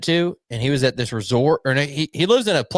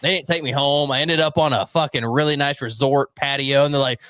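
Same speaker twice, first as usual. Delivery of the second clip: usual speaking voice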